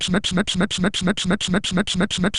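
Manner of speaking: soft, quick snip sounds in a row; mock snipping noise. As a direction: snip it out softly like little scissors.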